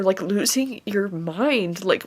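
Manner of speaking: ordinary talk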